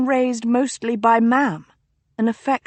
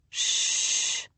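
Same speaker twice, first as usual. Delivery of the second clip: breathy hushing sound, much softer; 7 dB quieter than usual. A narrator's reading. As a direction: hush him like a secret. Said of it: "the Duke murmured".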